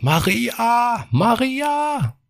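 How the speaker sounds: high-pitched call across the house. Acted. Husband calling his wife from the bedroom, high call. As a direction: call her name from afar.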